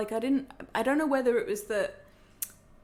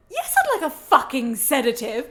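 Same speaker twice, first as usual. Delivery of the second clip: louder, fed-up snap back; exasperated retort; 3 semitones higher, 8 dB louder than usual. Retold or imitated. Patient snapping at the German doctor, exasperated and louder. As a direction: throw it back at him, annoyed.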